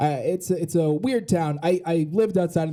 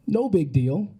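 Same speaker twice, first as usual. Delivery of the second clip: ordinary delivery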